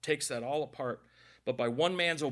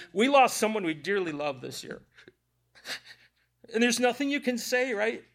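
real grief, voice pushed higher; crying; 6 semitones higher, 4 dB louder than usual. Real, not acted. Preacher recalling a loved one lost this year, tearful. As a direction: talk through tears. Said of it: "all that crying out of there".

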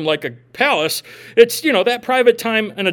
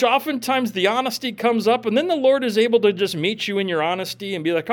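normal talking voice

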